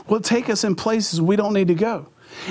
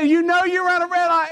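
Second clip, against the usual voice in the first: policeman repeats it higher and louder; 8 semitones higher than usual. Retold or imitated. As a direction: press him, raising the voice on the repeat. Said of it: "And he said".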